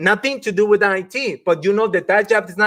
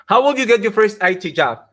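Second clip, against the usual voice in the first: ordinary talk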